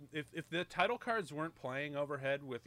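usual speaking voice

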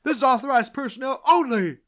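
loud, high mock scream; screaming sergeant. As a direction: yell it as an outraged sergeant. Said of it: "screaming line by Sargent".

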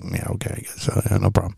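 low, soft, noncommittal mumble; acted halfhearted agreement. Reluctant man called someone's best friend, unconvinced. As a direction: play along without conviction.

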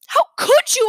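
high, loud cry of betrayal; shouting. A narrator's reading. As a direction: yell it at your parents. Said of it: "I shouted".

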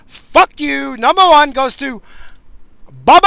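high, loud, slow build to a bellow; mock award announcement. Host revealing his number one enemy, derisive shout. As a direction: announce the winner in a furious drawn-out holler. Announces top hated person.

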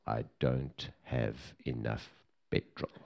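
put-on young driver telling the bodyguard she has no fuel; deadpan young lady. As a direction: drop it flat like bad news. Retold young driver's flat reply.

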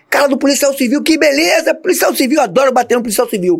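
high, mocking shout; gloating attacker. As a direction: taunt him as you beat him. Aggressor gloating while beating him. (provocação aos gritos, aguda e debochada)

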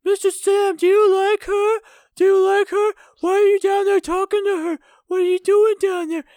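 put-on very high, loud girlish taunting; teasing little girls. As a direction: squeal like young campers teasing. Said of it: "girl campers going like".

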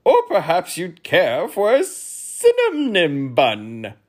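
high, loud, drawn-out duke; duke's voice. A narrator's reading. Duke suggesting a bun, high, loud and drawn out. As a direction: offer it slowly, high and loud. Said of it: "suggested the duke".

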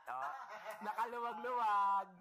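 delighted laugh spilling into the word; real happy laugh. Real, not acted. Laughing delight at own flat.

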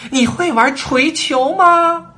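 high, slow, loud shouted question; loud call. A narrator's reading. Queen shouting to Alice as the procession leaves, loud call. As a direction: call it out across the distance. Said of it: "王后冲着爱丽丝大声喊道".

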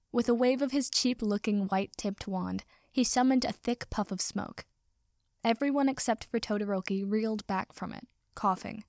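ordinary delivery, nothing marked

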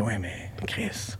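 very soft, low and slow; subdued mock exasperation; acted weary protest. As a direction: drop it to a low, drawn-out grumble. Acts out a discouraged reaction.

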